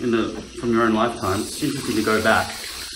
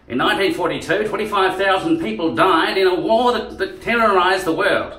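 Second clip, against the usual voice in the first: put-on high, loud old Australian race caller; old Australian accent; 7 semitones higher, 5 dB louder than usual. Newsreader telling of the war, old Australian accent. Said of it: "old Australian accent is more like a jockey".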